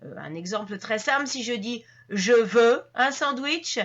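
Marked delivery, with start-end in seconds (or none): 2.1-3.8 louder and slow, a blunt demand; curt ordering demand for a sandwich. Shown as a demonstration. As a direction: say it as a command, no softening. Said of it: "c'est vraiment comme un ordre".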